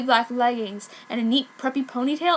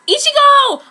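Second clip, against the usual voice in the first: shouted name, much higher and louder; calling out; 13 dB louder than usual. Acted. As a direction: call across the street to a friend. Calling out to a friend.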